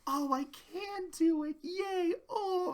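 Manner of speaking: a hint of the shy pony cheering herself on; timid Fluttershy. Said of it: "where she's just like".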